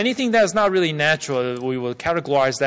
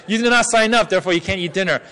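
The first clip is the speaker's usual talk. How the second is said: loud, raised stern ruling; 4 semitones higher, 4 dB louder, faster than usual. Shown as a demonstration. Interpreter demonstrating a warning to those not registered, very stern. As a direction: lay down the penalty sternly. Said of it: "if I said very sternly".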